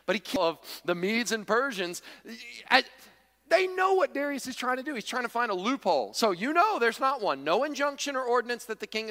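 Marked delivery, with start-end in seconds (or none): none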